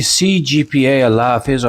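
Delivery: ordinary talk, nothing marked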